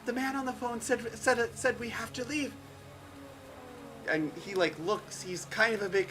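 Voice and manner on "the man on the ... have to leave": higher, stumbling woman's voice; anxious fleeing woman. Acted. Game master voicing a fleeing woman, anxious and higher. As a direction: stammer it out, pitched up.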